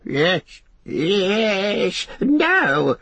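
high, drawn-out creature shriek. A narrator's reading. Gollum cornered by Frodo's question, shrieking. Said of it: "shrieked Gollum".

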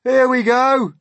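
high, loud whoop; excited call. A narrator's reading. Passengers feel the peach start down, loud excited call. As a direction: call out as the descent begins.

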